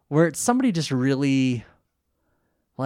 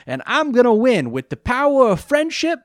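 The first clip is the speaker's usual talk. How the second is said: high, loud Joey voice with a lisp; over-the-top lisping Joey; weird lisp; 13 semitones higher, 6 dB louder than usual. Retold or imitated. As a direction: ham up a lispy Joey. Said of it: "I gave him, like, a weird lisp".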